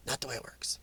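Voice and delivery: a soft, breathy whispered aside. Real, not acted. Whispering the rebuttal to his viewers first. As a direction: drop to a whisper under your breath.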